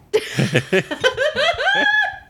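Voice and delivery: high shrieking laugh. Real, not acted. Big laugh.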